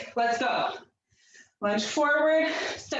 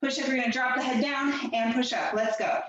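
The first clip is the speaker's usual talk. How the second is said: normal talking voice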